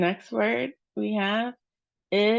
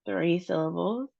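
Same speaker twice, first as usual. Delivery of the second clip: ordinary talk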